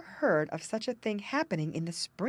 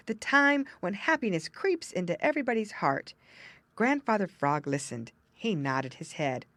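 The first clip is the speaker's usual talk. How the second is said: ordinary delivery, nothing marked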